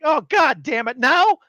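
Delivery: loud outburst climbing high; retold exasperation. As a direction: act fed up, peaking on the last word. Exasperated reaction to pudding appearing.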